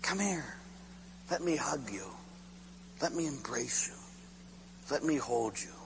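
very soft, with long pauses; tender invitation. Retold or imitated. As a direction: draw someone gently into an embrace. God tenderly offers an embrace.

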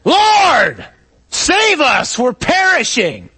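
high, loud shout over a storm; panicked yell. Acted. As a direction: yell it desperately to the back of a boat. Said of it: "one of us yells to the back of the boat".

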